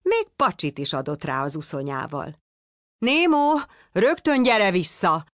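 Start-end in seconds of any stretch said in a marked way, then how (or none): 3.0-5.3 much higher, louder alarmed call; shouting. A narrator's reading. Frightened father yells to son at the boat. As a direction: yell him back right now. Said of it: "kiabált Pizsi".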